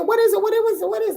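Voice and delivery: very high, rapid-fire eager pleading; eager questioning. Acted. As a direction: pester excitedly for the secret. Woman eagerly begs fish for secret.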